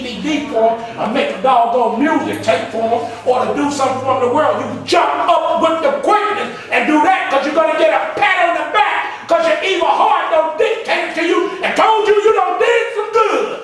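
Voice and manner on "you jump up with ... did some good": full-force preaching. Acted. Preacher chanting at the congregation, full force. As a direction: belt it to the congregation.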